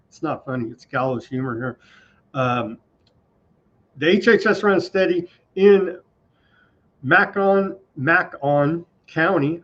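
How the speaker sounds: ordinary talk, nothing marked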